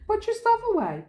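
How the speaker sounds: high, insistent order. Retold or imitated. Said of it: "I'm like".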